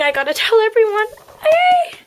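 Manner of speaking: high, loud, fast call out; bursting to share the news; excited call. Acted. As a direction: rush off shouting the news. Rushing off to tell everyone.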